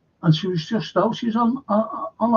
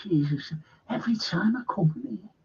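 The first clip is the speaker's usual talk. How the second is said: fast breathy whisper, pitch raised; whispered aside; 2 semitones higher, 8 dB quieter than usual. Real, not acted. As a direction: hurry it out under your breath. Muttered aside on entering.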